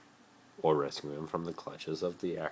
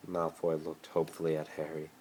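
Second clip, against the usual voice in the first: ordinary delivery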